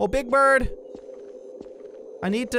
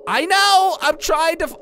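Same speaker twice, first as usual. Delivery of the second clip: high, loud protest; exasperated shout; 11 semitones higher, 8 dB louder than usual. Acted. Player answering the game's reminder, exasperated shout. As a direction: snap back loudly, pitched way up.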